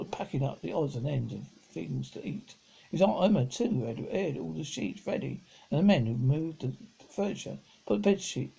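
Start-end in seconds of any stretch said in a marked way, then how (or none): none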